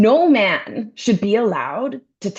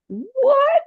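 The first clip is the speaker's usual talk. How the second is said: flat quote, then a squeaky much higher What?; repeating the man's words with incredulous what; mock outrage; 3 dB louder than usual. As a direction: quote him, then shriek disbelief. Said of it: "mocking his line".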